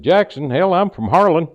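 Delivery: loud punchline, French act dropped; surprised exclamation. Acted. Maitre d' discovering a fellow Kentuckian, loud surprised exclamation. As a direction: burst out loudly in astonished recognition.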